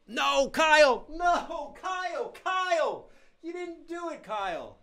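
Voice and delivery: high, wailing cry of protest; hammed-up despairing yell; mock anguished shout. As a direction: yell it out high, then let it fade. Mock despair at chat spoiler.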